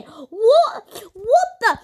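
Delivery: can't believe what just happened; excited disbelief. Real, not acted. Gamer telling viewers the car just sank: high, loud, amazed.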